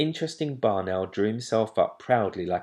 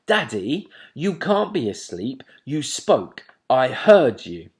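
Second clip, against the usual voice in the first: owlet insisting; 4 semitones higher, 8 dB louder than usual. A narrator's reading. Plop insisting.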